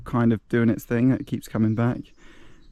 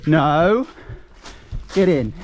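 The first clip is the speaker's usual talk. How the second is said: calling out; 5 semitones higher, slower than usual. Real, not acted. Calling dog in.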